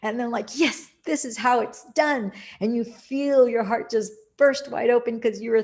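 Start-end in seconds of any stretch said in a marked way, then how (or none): 0.6-2.3 bright, lifted, higher voice of pure delight; thrilled. Pretend. Imagined self celebrating a manifested dream, thrilled. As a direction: sound like it just worked.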